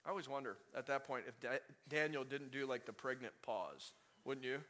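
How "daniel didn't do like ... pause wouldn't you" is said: ordinary talk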